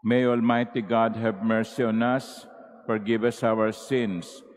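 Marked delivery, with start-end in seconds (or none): none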